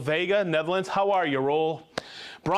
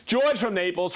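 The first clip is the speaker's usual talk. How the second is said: ordinary delivery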